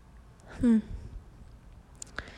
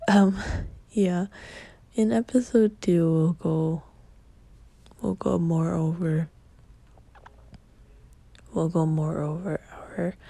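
usual speaking voice

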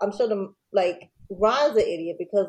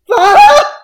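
a sudden, much higher and louder "Fuck!"; furious shout; 15 dB louder than usual. Retold or imitated. Joe learning the check bounced, screaming in frustration. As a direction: explode as the check won't clear. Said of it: "So Joe's like".

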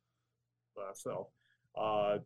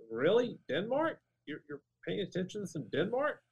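higher, disbelieving rise on each Denmark; incredulous; 9 semitones higher than usual. Retold or imitated. Guest retelling his reaction to a Danish interviewer, incredulous. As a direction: ask it as if you can't believe your ears. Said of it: "And I'm like".

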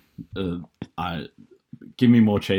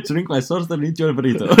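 higher-pitched mock Spanish accent; Rafael Nadal; 5 semitones higher, faster than usual. Friend finishes the burrito phrase for a laugh, mock Spanish accent. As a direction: push through in a playful Spanish accent. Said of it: "I'm just thinking of Rafael Nadal here".

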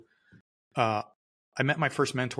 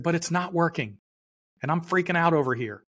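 raised, pleading voice reliving the panic; retold panic; 7 semitones higher, 3 dB louder than usual. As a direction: plead as if the money is running out. Retold panic over failing company.

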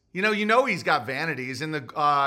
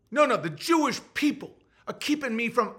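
higher put-on protest; 5 semitones higher than usual. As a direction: lightly mimic his objection. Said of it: "And he'd be like".

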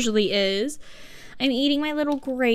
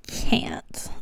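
whisper; 3 dB quieter than usual. Pretend. Whispered despair mid-rant.

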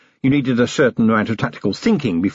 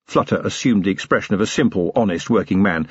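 ordinary delivery

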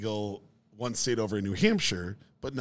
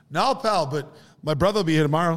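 loud, higher-pitched mock Boston accent; bad Boston accent, pushed loud; imitated Boston bartender; 5 semitones higher, 6 dB louder than usual. Bartender turning down a departing customer, hammed-up Boston accent. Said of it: "Bartender's like".

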